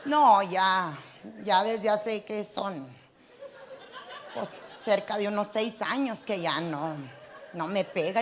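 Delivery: put-on quiet old woman. Voices the old woman's reply.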